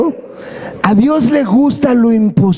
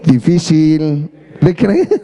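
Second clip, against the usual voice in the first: "Difícil de creer" very slow, low, "creer" stretched long with a rising end; drawn-out inner doubt, lower and slow; doubtful inner voice; 7 semitones lower, much slower than usual. Retold or imitated. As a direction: sceptical thought, dragged out. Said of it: "en nuestra mente decimos".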